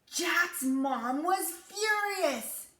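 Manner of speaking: usual speaking voice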